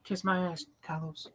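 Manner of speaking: muttered insult. Retold or imitated. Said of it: "I'm like".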